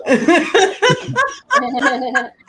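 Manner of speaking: high, bursting laugh; big laugh. Real, not acted. Comic laughing at the host's teasing, high burst of laughter.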